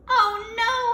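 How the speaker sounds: high, loud cry of dismay; dismayed holler. Acted. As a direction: holler it together. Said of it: "we all hollered".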